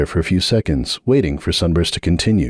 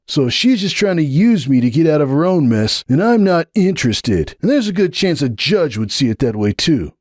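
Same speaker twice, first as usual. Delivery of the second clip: cold defiant stallion, much higher; refusing flatly, pitched up and firm; Hoops' voice; 10 semitones higher than usual. A narrator's reading. Hoops refusing Sunburst's plea, cold and defiant.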